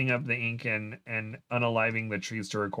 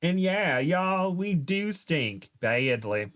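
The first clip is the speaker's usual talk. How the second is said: put-on Southern accent; 7 semitones higher, slower than usual. A narrator's reading. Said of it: "Her accent is back".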